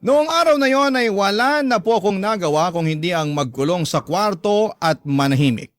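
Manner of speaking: usual speaking voice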